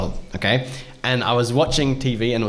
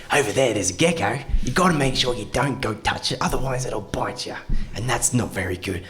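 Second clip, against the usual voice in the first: put-on outback Aussie accent; 2 semitones lower, 3 dB quieter than usual. Outback Aussie warns about a gecko, broad Australian accent. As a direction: warn them like an outback Aussie. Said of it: "then there's the outback Aussie".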